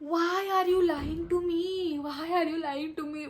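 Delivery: hurt accusation. Retold or imitated. Daughter accuses mom of lying about Kashmir, high and aggrieved. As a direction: protest like a betrayed child. Retold confrontation over the lie.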